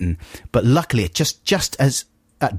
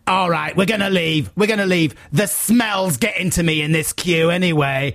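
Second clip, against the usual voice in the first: higher-pitched huffy exit line; put-on raised voice, mock disgust; cartoon matriarch; 6 semitones higher than usual. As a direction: mimic an over-the-top matriarch storming off. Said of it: "like a kind of cartoon matriarch woman".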